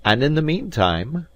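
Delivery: meantime leaned on hard and high; stress on meantime. Acted. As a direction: punch the word meantime. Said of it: "laying a strong accent on the word".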